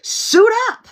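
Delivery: loud, rising heroic call; superhero rally call. Retold or imitated. Rallying the Avengers, a loud hammed-up battle cry. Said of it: "And I'm like".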